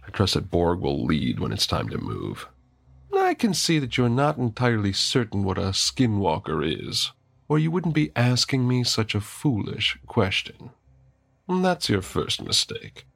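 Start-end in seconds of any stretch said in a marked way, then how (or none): 3.1-13.1 higher, smooth-talking villain. Acted. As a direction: lecture him like a sly villain. Villain character voice.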